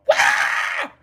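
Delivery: high breathy attack cry; monster lunge cry. Retold or imitated. Zombie man dives on victim.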